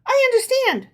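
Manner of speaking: sly, in on the joke; knowing. A narrator's reading. Herschel's wife answering her husband, arch and knowing. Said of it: "said Herschel's wife knowingly".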